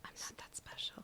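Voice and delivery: soft whispered aside; whispering. Real, not acted. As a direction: breathe it out, modest. Guest modestly deflecting.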